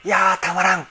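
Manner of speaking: real delight; excited reaction. Real, not acted. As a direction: savour the moment aloud. Reacting to singing.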